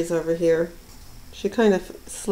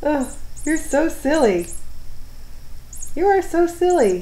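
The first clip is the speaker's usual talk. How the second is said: much higher, louder sing-song; pet talk; 9 semitones higher, 3 dB louder than usual. Real, not acted. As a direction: gush adoringly at the cat. Cooing at silly cat.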